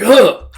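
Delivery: high, loud cry on impact; acted gasp of being slammed; pained grunt. Griffith hits a pillar.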